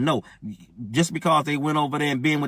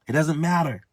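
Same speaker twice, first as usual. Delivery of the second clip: normal talking voice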